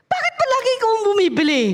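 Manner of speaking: put-on high, fast complaining child voice; high-pitched kid whining to a sibling; jealous sibling. Sibling complaining about errands.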